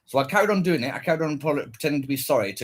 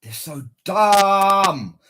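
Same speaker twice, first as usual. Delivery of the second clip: exasperated; 5 semitones higher, 6 dB louder, much slower than usual. Real, not acted. Drawn-out exasperation.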